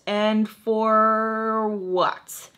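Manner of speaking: very slow, stretched FOR and WHAT; drawn-out challenge. Retold or imitated. Woman dismissing her rival, slow drawn-out challenge. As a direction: drag out "for what" mockingly. Retold sassy repeat, drawn out.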